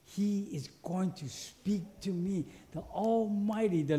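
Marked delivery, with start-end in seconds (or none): 0.0-2.4 awed attitude. Retold or imitated. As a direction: softly marvel at the privilege, lower voice. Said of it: "with an attitude like".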